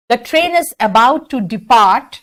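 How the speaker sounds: stretched out, each word clear; slow model sentence. Shown as a demonstration. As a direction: model it slowly for students. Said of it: "Going to go अच्छा नहीं लगता".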